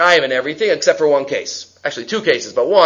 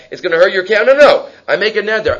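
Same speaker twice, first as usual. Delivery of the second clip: ordinary talk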